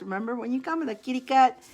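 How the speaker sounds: usual speaking voice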